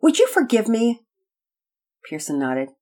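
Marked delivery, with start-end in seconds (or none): 0.0-1.1 put-on higher, pleading little-girl voice; sweet, contrite little sister; sweet and humble. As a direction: humbly ask to be forgiven. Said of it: "Penelope remarked sweetly and humbly".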